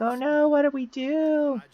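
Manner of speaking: high-pitched, hammed-up wail; mock panic. Group trapped as memories get erased, mock panic. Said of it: "they're all panicking".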